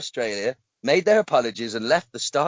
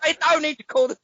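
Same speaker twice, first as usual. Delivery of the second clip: higher, hammed-up Cockney bloke saying mate; mock Cockney; 9 semitones higher, 3 dB louder than usual. Host acting out the machete man apologising to the victim, mock Cockney. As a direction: play a cheeky Cockney intruder apologising. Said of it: "I've gone all cockney".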